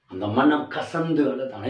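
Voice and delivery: ordinary talk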